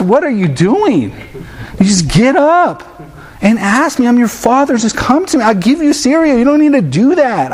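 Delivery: high, incredulous fatherly scolding; a dad baffled his own child won't just ask; exasperated dad. Retold or imitated. Dad answers the daughter.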